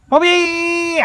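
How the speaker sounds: high drawn-out call; calling the dog. Real, not acted. Man hollering for Bobby the dog, drawn-out call. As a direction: shout for the dog, stretching the name.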